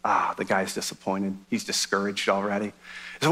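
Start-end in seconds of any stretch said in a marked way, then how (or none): none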